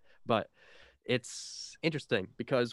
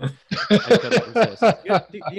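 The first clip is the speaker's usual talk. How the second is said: giggling apology after a pun; real laughter breaking into the line; laughing; 9 semitones higher, 12 dB louder than usual. Real, not acted. Laughing at own joke.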